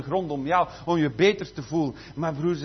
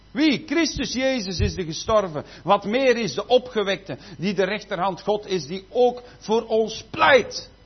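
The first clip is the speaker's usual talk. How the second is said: louder, slow and weighty; forceful preaching; 4 dB louder than usual. Acted. Preacher reading Romans to the church, slow and forceful. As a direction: thunder the verse at the congregation.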